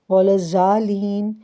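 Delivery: الضالین with a z-like zwaa sound; wrong recitation. Shown as a demonstration. Teacher showing the mistake, الضالین said with z. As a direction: say الضالین as az-zwaalin, buzzing zwaa. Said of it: "زوا اور زا کا تلفظ نکالتے ہیں".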